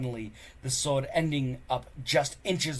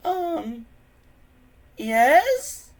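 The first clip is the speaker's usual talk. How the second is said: much higher, unsure yes; hesitant Fishlegs; 11 dB louder than usual. Acted. Friend hiding the truth from Hiccup, high hesitant. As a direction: agree to spare his feelings.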